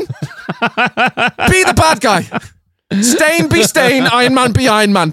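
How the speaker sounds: high-pitched, exasperated pleading; mock pleading. Riffing to co-host on the film, mock pleading.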